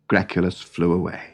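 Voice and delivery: usual speaking voice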